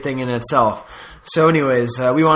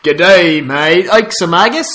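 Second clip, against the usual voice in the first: bad Australian accent drifting Scottish; Australian accent slipping Scottish; 3 semitones higher, 5 dB louder, slower than usual. Shown as a demonstration. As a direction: start Aussie, slide into Scottish. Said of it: "it always slips into a Scottish accent".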